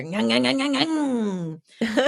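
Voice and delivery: nasal ngang ngang of a door being yanked; hammed-up rattling door noise. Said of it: "you hear".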